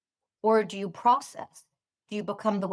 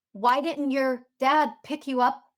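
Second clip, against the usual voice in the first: ordinary delivery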